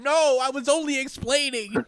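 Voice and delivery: high startled protest; pained cry. Retold or imitated. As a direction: yelp it as the lasers hit. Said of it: "cries out to his own body".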